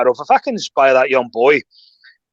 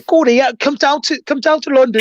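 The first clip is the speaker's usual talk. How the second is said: high-pitched put-on Scottish accent; mock Scottish accent; 8 semitones higher than usual. Retelling a player's invitation down to London, mock Scottish accent. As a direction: exaggerate his Scottish accent, pitched well up. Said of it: "with his good Scottish accent".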